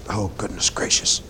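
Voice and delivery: breathy whispered aside under the breath. Real, not acted. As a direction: mutter it to yourself, barely voiced.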